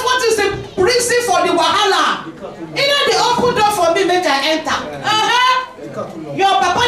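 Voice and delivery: high-pitched, incredulous preaching; indignant challenge. Real, not acted. Preacher to congregation on car-door demands, incredulous and high. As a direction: take the congregation to task, pitched right up.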